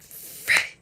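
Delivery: breathy unvoiced curse; whispered frick. Cursing to herself as security pulls her aside, whispered. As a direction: let it slip out under your breath.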